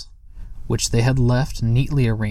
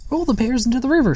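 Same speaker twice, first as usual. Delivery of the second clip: much higher and fast, eager shout; excited scream; 13 semitones higher, faster than usual. Acted. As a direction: blurt it out thrilled and racing. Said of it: "screamed Sniff excitedly".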